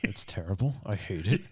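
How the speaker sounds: mock grumble through clenched teeth. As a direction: squeeze it out through clenched teeth, half joking. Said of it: "As he says through clenched teeth".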